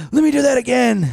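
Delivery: high, loud, thrilled outburst; acted eager excitement. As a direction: burst out begging for another ride. Acting out eagerness after the ride.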